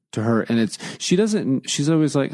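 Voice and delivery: ordinary delivery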